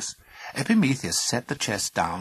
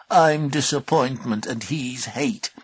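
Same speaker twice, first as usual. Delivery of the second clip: creature voice; 5 semitones higher, 5 dB louder, slower than usual. Acted. Creature announces itself.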